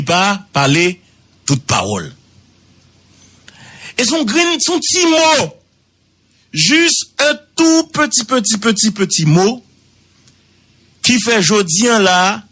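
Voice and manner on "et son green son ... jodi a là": emphase aiguë. Shown as a demonstration. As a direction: raise the voice way up, stretching "petit, petit". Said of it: "emphasizing the tiny word". (voix très aiguë, insistante)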